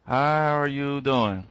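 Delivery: drawn-out greeting. Acted. Trainer re-greeting Tish in a chat: slow, low drawl. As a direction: stretch it out low and easy like a crooner. Repeats the greeting slowly and deeply.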